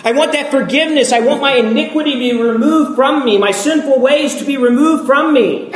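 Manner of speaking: high gleeful whoop, then words tumbling out fast; a churchgoer gushing over free forgiveness, hammed-up; mock excited whoop. Congregant cheering at forgiveness, mock excited and high. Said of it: "and we go".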